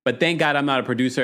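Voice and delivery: ordinary delivery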